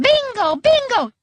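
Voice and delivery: very high-pitched jubilant cry; excited yell. A narrator's reading. Grandma when the ball does what she wants, excited yell. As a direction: whoop it like you scored. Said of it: "yelling this excitedly".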